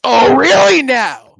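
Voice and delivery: loud, high, slow and drawn out; sarcastic disbelief. Retold or imitated. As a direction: stretch it like you caught her. Reacting to racist reveal.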